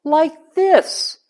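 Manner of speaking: sing-song parentese, high pitched. Shown as a demonstration. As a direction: swoop up like talking to a baby. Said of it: "often they'll talk like this".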